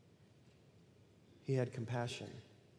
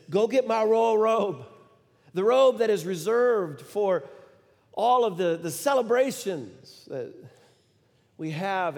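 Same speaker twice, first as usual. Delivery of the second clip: higher, louder excited command; joyful shout; 7 semitones higher, 9 dB louder than usual. Retold or imitated. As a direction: call out to the servants in joy. Father calling servants in joy.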